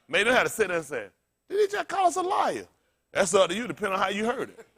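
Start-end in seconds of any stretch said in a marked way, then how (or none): 1.5-2.7 much higher, indignant question; mock offended. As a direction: squeak out the offence. Mocking offended listeners.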